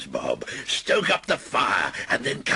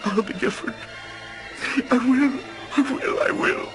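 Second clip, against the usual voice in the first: high, louder frantic promise; desperate pleading; 4 dB louder than usual. Acted. Scrooge begs the phantom to spare him, desperate. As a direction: beg for another chance.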